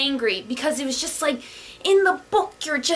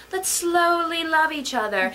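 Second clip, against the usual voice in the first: dreamy slow lover; 3 semitones higher, slower than usual. Retold or imitated.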